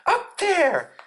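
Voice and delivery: very high little squeak; frightened Piglet. A narrator's reading. Piglet waving his paw toward the trap, high frightened squeak. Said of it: "said Piglet".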